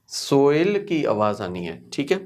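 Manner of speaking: normal talking voice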